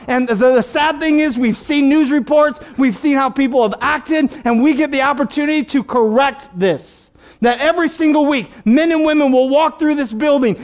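high, driving pulpit delivery; insistent, rolling sermon exhortation; impassioned preaching. Real, not acted. Impassioned preaching at raised pitch.

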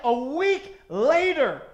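slow, loud, drawn-out build; ringing pulpit climax; climactic preaching. Real, not acted. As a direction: stretch it out at full force. Climax, then repeated hushed.

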